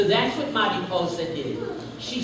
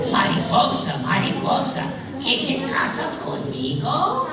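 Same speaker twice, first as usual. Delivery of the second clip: pig voice; 7 semitones higher than usual. Acted. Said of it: "And so he said".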